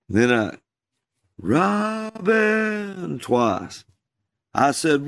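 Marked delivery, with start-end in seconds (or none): 1.4-3.2 high, drawn-out call from across a pasture; distant call. Retold or imitated. Mysterious voice calling a boy across the pasture, high and drawn out. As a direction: call the name like a far-off voice. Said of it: "I heard this sound".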